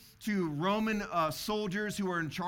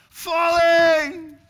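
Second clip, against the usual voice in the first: full-throated yell, much higher and louder; warning yell; 11 semitones higher, 15 dB louder than usual. Retold or imitated. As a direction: shout the alarm to the rope team. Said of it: "and I yell".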